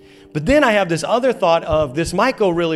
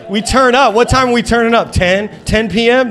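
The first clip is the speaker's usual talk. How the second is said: loud, high hype-man call; mock party hype; 8 semitones higher, 7 dB louder than usual. Church boasting it parties, loud mock hype. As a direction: hype up the crowd like a party starter.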